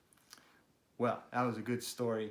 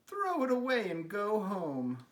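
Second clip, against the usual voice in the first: mocking robins; 8 semitones higher, 5 dB louder than usual. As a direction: sneer down at the broken kite. Robins mock again.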